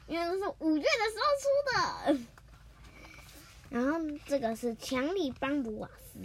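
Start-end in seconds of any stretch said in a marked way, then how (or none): 0.1-2.2 eager rush. Real, not acted. Excited explanation.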